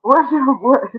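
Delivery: ordinary delivery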